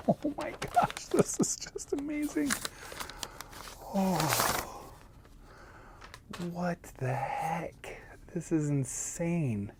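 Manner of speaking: hushed, breathless amazement; awed whisper. Real, not acted. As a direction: whisper it like you can't believe your eyes. Awed unboxing of special edition.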